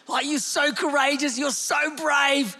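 a lightly voiced king, warm praise; imagined proud king. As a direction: gush like a proud king cheering a boy on. Imagined king praising young David.

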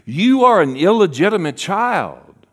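high, loud accusation; accusers. Acted. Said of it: "they would say".

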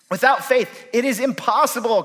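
"impossible" hit high and loud; quoting scripture with "impossible" ringing out; stress on impossible. Shown as a demonstration. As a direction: lean hard on "impossible" as the verse lands. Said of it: "Can I say impossible?".

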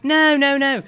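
loud, flustered no no no; loud protest. Acted. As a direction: shout a quick denial. Hurried correction at reception.

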